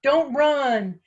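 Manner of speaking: urgent shouted plea, building; yelling. A narrator's reading. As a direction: yell a warning after someone running. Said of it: "gasping and yelling".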